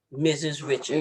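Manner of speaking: low, measured impression of a battle rapper. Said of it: "I really like when [name] talk".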